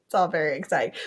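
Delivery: normal talking voice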